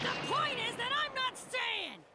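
loud, slow, defiant declaration; I'm not staying, loud and stubborn; defiant shout. Acted. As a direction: shout it out, refusing to stay.

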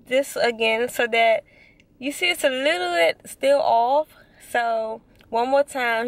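talking with lip held down; speaking around finger; finger pulling lip down. Real, not acted. Seen 4: Finger pulls lower lip down to show braces while talking.

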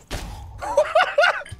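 high giggling laugh. Real, not acted. Gamer beating a zombie, laughing. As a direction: crack up laughing.

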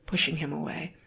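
plain reading voice

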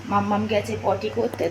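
ordinary talk

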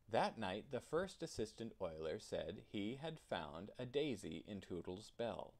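ordinary delivery

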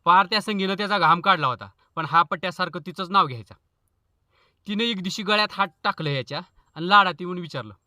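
ordinary talk

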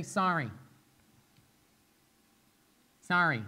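short, perfunctory sorry said just to get off; insincere sorry. Retold or imitated. Parent mimicking his little guy's learned apology, perfunctory. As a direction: mimic a child's quick, token apology. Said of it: "I say it like that".

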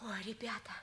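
hushed conspiratorial whisper. Acted. Warning classmates to keep quiet, whispered. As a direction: breathe it so no one overhears. Secret talk among friends.

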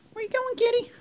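very high, sing-song cooing. Real, not acted. Coaxing the cat to come over in squeaky baby talk.

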